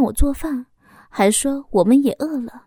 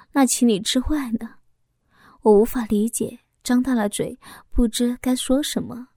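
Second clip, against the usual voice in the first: ordinary delivery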